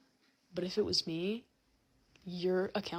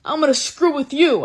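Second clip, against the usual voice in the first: suspicious teacher; 5 semitones higher, 13 dB louder than usual. Retold or imitated. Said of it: "the teacher's, like, suspecting things".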